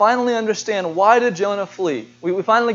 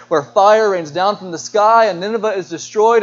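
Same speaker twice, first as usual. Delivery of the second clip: normal talking voice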